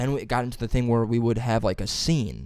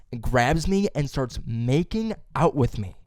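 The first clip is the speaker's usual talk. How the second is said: ordinary delivery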